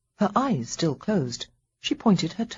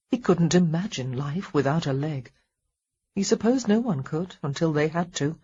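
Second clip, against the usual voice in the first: ordinary delivery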